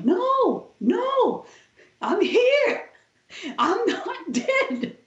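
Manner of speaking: dying woman insisting to the nurses, very high, ending in a laugh; desperate protest. Retold or imitated. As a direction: cry out high that you're still here. Protesting she is alive.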